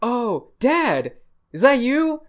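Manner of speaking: put-on high, childish surprise; a kid spotting his father; kid's voice. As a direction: squeak it like a little boy. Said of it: "the kid comes up and is like".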